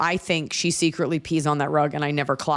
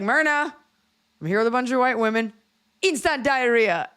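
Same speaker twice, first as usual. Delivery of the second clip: put-on drunk excited call; 7 semitones higher than usual. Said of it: "And I was like".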